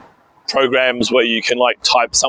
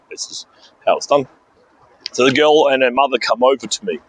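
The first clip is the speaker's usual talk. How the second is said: normal talking voice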